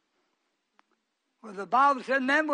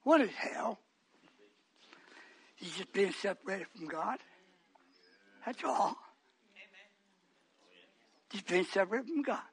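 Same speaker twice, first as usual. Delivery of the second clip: much lower, soft, spaced-out preaching; hushed preaching; 6 semitones lower, 10 dB quieter than usual. Real, not acted. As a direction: draw them in with a hush.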